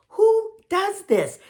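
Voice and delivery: high, slow, stretched-out disbelief; amazed disbelief. Real, not acted. To the viewers about the kind couple, high slow disbelief. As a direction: marvel at it, drawing every word out up high.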